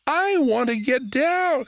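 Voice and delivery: put-on high, drawn-out kid's whine; child whining. A narrator's reading. Boy stuck in a tree begging the bear, high whine. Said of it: "Orson whined".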